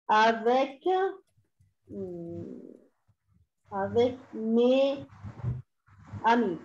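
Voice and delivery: very slow, word by word; slow dictation. Shown as a demonstration. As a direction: dictate in drawn-out chunks with long pauses. Dictating the second French sentence.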